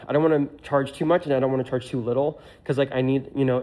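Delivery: ordinary talk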